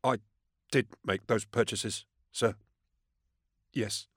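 halting, softened admission; meek and hesitant, pitched a little up; small voice. A narrator's reading. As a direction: confess in small, broken pieces. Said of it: "In a small voice".

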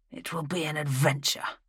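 talking through a mouthful; words round a bite of food; mouth full. A narrator's reading. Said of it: "through a mouthful of food".

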